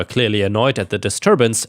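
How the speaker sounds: plain reading voice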